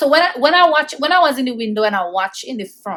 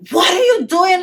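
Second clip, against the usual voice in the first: high, loud protest; retold outraged yell; 9 semitones higher, 5 dB louder than usual. Child to police cuffing her cousin, high outraged yell. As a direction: cry out in re-enacted disbelief.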